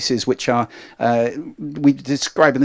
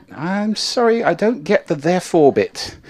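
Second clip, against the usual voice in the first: higher-pitched mock-baffled reply; mock puzzlement; 7 semitones higher than usual. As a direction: act politely baffled by the answer.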